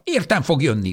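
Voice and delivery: high, loud insistent cry on Értem; stubborn old woman shouting Értem; angry shout. Acted. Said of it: "kiáltotta".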